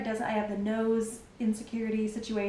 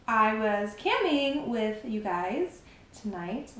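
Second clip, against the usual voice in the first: ordinary delivery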